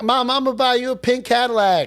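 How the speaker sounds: high, put-on Southern son. Son promising his mother a gift, high Southern impression. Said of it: "sorry, my [name] impression".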